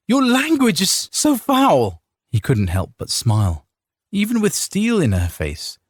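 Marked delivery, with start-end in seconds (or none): 0.1-2.0 scolding wife. A narrator's reading. Wife scolds husband's cursing.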